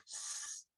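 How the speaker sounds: soft, drawn-out voiceless hiss; snake hiss. Shown as a demonstration. Snake teacher invites kids to respond, soft hiss. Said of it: "making a snake-like motion".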